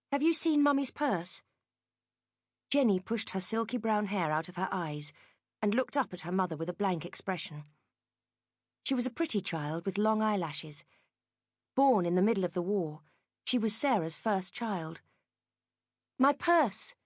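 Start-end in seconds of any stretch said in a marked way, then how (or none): 0.1-1.4 lightly voiced mother, higher, anxious; a harried mum asking her little girl; mother's anxious question. A narrator's reading. Mother asks daughter about lost purse, lightly anxious. 16.2-16.9 clipped impatient repeat. A narrator's reading. Sarah repeats the question to Jenny, impatient. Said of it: "Sarah said impatiently".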